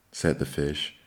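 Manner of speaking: usual speaking voice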